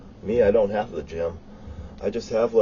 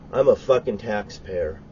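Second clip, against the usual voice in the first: ordinary delivery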